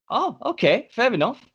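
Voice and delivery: high-pitched mock casual reply; high, doubtful going-along; bemused acceptance. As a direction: let the voice float up, humouring him. Acts out his bemused reply.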